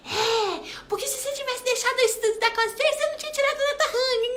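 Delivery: high, fast, laughing I-told-you-so; mocking old nagging self. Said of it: "antigamente eu brigava". (mãe implicante de antigamente, aguda e rindo)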